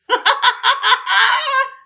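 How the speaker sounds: loud high laughter; Man still forcing laughter; roaring laugh. A narrator's reading. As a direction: keep roaring. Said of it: "roared Man".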